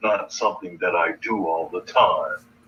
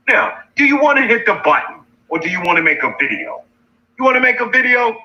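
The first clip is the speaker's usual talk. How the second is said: fast, higher and louder taunt; heated taunting challenge; 12 semitones higher, 4 dB louder, faster than usual. Real, not acted. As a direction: fire it off fast, goading. Challenging rival, pitch rising.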